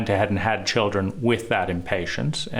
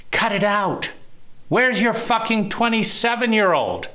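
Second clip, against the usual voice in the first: enraged; 10 semitones higher than usual. Retold or imitated. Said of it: "I feel enraged".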